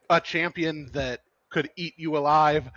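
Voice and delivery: usual speaking voice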